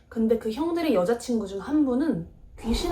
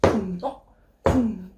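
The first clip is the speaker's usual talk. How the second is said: imitated thumps, slow and spaced out; slow, measured knocking noise; slow thudding beat; 3 dB louder, much slower than usual. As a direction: sound out heavy thuds with long gaps. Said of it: "느린 박자로".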